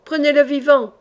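loud, high howling order; howling command; howled. Acted. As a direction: bellow it at the men. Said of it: "dans le même hurlement".

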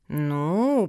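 long, stretched single word; drawn-out hesitation. A narrator's reading. Turtle considering the pugs' plea, drawling. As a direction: draw the word out, pondering. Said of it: "протянула черепаха".

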